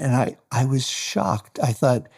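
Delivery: normal talking voice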